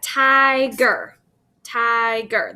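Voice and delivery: "tiger" slowly split as ti, ger; syllable by syllable. Shown as a demonstration. Teacher showing where the syllable break is, split in two. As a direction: break "tiger" after a long ti, twice over. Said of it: "There's my syllable break".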